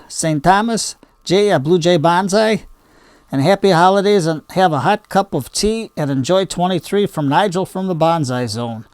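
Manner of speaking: ordinary delivery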